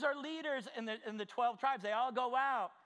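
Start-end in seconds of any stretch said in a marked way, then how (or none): none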